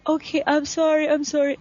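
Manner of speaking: high, breathless repeated sorry; flustered apology. Retold or imitated. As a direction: gush a nervous sorry. Flustered apology after his kindness.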